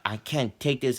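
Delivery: retold yell. Classmate losing patience with rowdy boys, mock yell. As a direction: blurt it like he's had enough. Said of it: "yelling at them".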